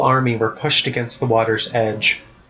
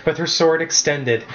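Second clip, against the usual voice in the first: plain reading voice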